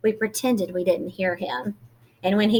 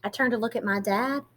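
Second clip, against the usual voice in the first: usual speaking voice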